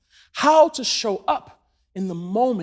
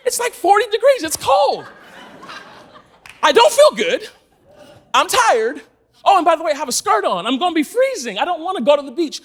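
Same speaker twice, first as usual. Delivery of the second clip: higher, louder protest; mock complaining; 6 semitones higher, 5 dB louder than usual. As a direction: imitate a fed-up girlfriend objecting. Acts girlfriend's complaints about beach.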